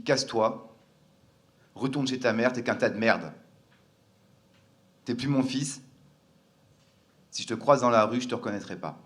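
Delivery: a hint of a harsh father casting out his son; father's big voice. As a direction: retell a father's forceful rejection, mildly acted. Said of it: "avec sa grosse voix".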